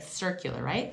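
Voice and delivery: normal talking voice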